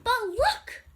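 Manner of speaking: loud high story shout; yelled. A narrator's reading. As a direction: yell it out. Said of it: "I yelled".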